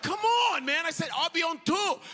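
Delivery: high loud exasperated plea; exasperated protest. Retold or imitated. Tired of French corrections, a high loud plea.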